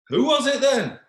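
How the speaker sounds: very high, loud demand; demanding king. Acted. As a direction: burst out demanding the culprit.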